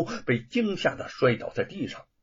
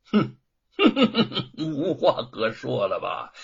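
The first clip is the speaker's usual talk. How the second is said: hmph and a mocking laugh, slow; slow taunting question through a laugh; gloating sneer; 3 dB louder than usual. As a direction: sneer at the cornered man. Magistrate gloats over silenced prisoner.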